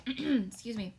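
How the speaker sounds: usual speaking voice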